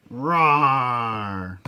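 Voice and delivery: playful monster roar; dinosaur voice. Acted. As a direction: growl it like a toy dinosaur. GM voices the wolf.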